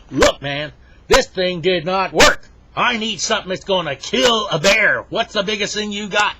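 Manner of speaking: loud, high, acted outrage; furious customer. Henry confronts the gun shop owner, absolutely furious. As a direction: demand it at full blast. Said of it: "he is absolutely furious".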